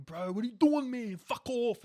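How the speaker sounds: loud, high Western suburbs bro; brash Western suburbs accent, building to a shout. Acted. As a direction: yell it like a Western Sydney bro. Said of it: "bro, like that kind of an accent".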